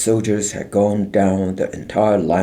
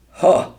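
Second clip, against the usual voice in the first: mocking ha; 10 semitones higher, 7 dB louder than usual. A narrator's reading. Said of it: "said one of the soldiers".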